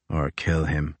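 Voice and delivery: low, dry and quiet; grim indifference. Acted. Crozier about Hickey, low dry grim remark. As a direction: let him die, for all you care. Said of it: "His tone suggested that this would not be the worst".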